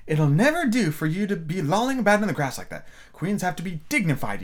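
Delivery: mock-severe self-scolding. A narrator's reading. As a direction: scold yourself like a prim governess. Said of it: "she went on in a severe tone".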